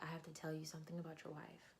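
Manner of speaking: soft, quick near-whisper; hushed confiding. Retold or imitated. Friend breaking news about a wife, hushed. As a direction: confide it quickly under your breath.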